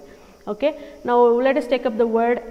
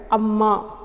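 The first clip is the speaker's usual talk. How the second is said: amma with the weight on the second syllable, ma; stress on amma. Shown as a demonstration. Teacher showing where the stress falls. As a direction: press the ma in amma. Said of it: "Where am I stressing here".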